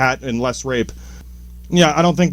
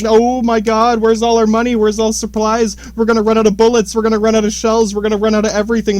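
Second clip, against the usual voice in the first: higher and louder, rushing faster at the end; mock panic; 7 semitones higher, 3 dB louder than usual. A nation fretting about running dry, in hammed-up panic. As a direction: play a country panicking about running out of supplies.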